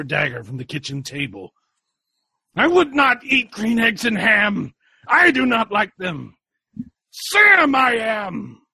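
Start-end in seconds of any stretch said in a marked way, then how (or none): 2.6-8.6 high, loud battle cry; triumphant shout. Acted. Sam shouting as he lunges at Dracula. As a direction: roar it as he strikes.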